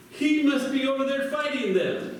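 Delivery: realising it aloud, lightly voiced; lightly voiced soldier. Soldiers guess where Jonathan is.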